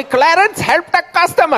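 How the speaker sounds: put-on high-pitched Indian-accented manager voice. Indian manager summons Clarence to help a customer, high accented call. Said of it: "he's Indian".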